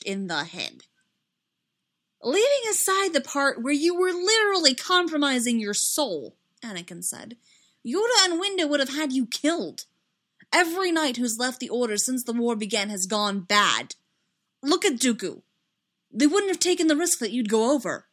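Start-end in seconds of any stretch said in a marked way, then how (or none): 2.2-6.4 loud, high, incredulous protest; worked-up, emphatic rebuke; heated argument. A narrator's reading. Anakin argues heatedly with friend. 7.8-18.1 loud, raised, urgent protest; agitated and emphatic; heated argument. A narrator's reading. Anakin argues heatedly with friend.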